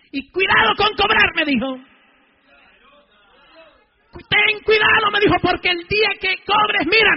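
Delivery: loud, high warning; full-force preached warning. Real, not acted. God warning him about charging money, retold in full-force preaching. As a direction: hurl the warning at the congregation.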